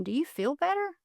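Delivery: soft, high, gentle question; genuinely concerned. Shown as a demonstration. Showing how to ask the insulter, genuinely concerned. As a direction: ask it tenderly, lifting in pitch. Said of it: "act genuinely concerned".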